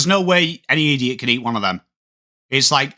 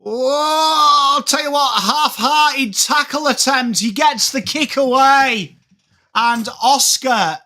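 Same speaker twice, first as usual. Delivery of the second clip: excited shout; 9 semitones higher, slower than usual. Acted. Commentator calling a half-hearted tackle, high and shouting. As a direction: shout the tackle play as it happens.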